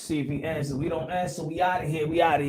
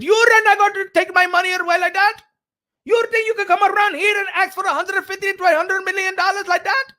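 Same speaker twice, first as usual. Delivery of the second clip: high, loud indignant rich boss ranting, bursts of shouting; hammed-up outraged sheikh refusing to be used; mock Excellency; 6 dB louder than usual. Streamer voicing the Excellency yelling at Canelo, high and outraged. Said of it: "The Excellency ain't trying to play around".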